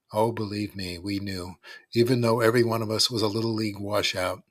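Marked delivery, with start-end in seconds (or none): none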